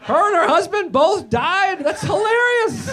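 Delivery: high, loud, drawn-out mock delight; gleeful disbelief. Comic hears the bride and her husband died, gleeful mock delight. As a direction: react with hammed-up glee to awful news. Acts gleeful reaction to the news.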